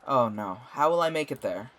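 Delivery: ordinary talk, nothing marked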